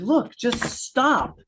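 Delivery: high, very slow, firm "look, just stop"; firm quote to client. Retold or imitated. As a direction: spell it out to her slowly. Telling client to stop exercising.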